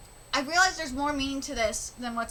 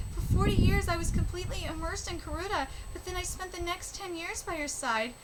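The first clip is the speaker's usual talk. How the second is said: higher, earnest reading voice for the characters; reading manga aloud; 5 semitones higher, 3 dB quieter than usual. Acted. Vlogger reading karuta manga dialogue to viewers, earnest and higher. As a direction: read each speech bubble with feeling.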